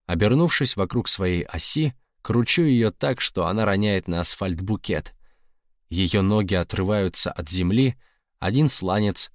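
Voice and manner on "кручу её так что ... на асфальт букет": plain reading voice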